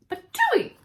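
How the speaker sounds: high loud patooey spit; spitting out porridge. Acted. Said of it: "cried Goldilocks".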